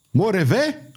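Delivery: understated narrated yell; yelled name. A narrator's reading. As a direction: call the name out high, questioning. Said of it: "urlă Pardaion".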